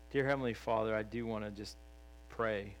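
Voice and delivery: soft closing prayer. Acted. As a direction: pray it low and humble.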